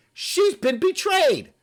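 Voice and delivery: high, slow mocking echo of her words. Ridiculing her claim, mocking and high. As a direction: sneer it back high and slow.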